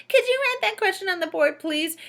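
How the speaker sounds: put-on high, whiny parody of the child; mocking voice. A narrator's reading. Teacher mocking Rondi's question, high whiny parody. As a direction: mimic them in a sneering squeaky voice. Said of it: "in a mocking voice".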